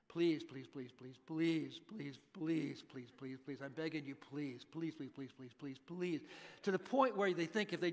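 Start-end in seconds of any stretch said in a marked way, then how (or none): 0.1-6.2 lightly imitated child's nonstop pleading; soft, repetitive begging that won't let up. Young child pestering a parent for something, lightly imitated begging. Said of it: "young children who really want something bad and they just don't stop".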